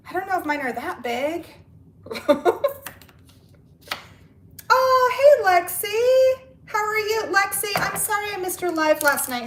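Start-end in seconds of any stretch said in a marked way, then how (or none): 4.7-9.4 high, loud hello easing slightly into apology; real excited greeting. Real, not acted. Host greeting a friend who joined the live, excited. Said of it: "higher and louder when I get excited".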